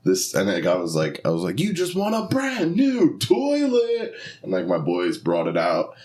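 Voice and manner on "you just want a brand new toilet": high, drawn-out prize announcement; game show announcer. Retold or imitated. Host presenting a raffle winner with the grand prize, high hammed-up announcer.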